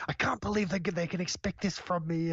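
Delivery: mock angry outburst. Podcaster imitates Gene Wilder losing it in the bath, mock angry. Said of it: "getting really angry about".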